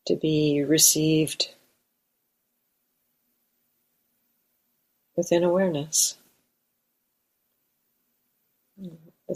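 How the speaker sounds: calm, unhurried meditation guidance with long pauses; guided meditation. Real, not acted. Teacher guiding meditators to arrive in the body, guided meditation. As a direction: settle listeners gently, leaving space between phrases.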